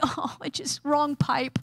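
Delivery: strained words through a blocked throat; hammed-up choked excuse; choked, playing it off. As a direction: act half-choking while pretending to be fine. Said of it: "trying to play it off like you're okay".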